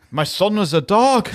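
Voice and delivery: high, drawn-out outraged disbelief; hammed-up horrified revelation; mock shock. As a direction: wail it slowly like a clueless film hero. Acting clueless man misreading the clue.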